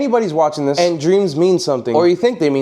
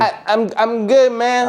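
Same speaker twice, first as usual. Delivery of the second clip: slow, stuttered, lightly pitched up; mock stuttering reply; 5 semitones higher, slower than usual. [name] mocks how Carlos answered. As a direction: stumble over the words. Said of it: "Just kept walking".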